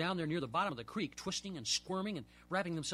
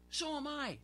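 much higher, excited answer; crying back; 10 semitones higher, slower than usual. Acted. Boy crying back to his friend while reeling in. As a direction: toss it back eagerly. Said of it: "I cried back".

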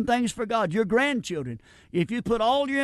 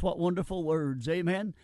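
ordinary delivery

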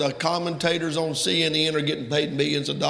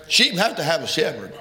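ordinary talk

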